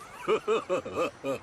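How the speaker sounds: rolling theatrical chuckle; self-satisfied laugh; chief's chuckle. Acted. Chief chuckles after reassuring Ricky.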